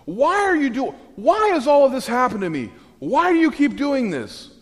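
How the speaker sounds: hammed-up anguished wail; anguished complaint. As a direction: cry out to God in despair. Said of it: "he just addresses God and says".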